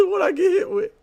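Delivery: high quote through laughter; quoting him while cracking up; laughing quote. Retold or imitated. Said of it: "He said".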